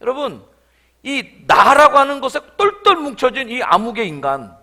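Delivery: loud, rising passionate sermon; full-force preaching. Acted. Pastor denouncing the selfish man to the congregation, fervent. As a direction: drive the point home with fervour.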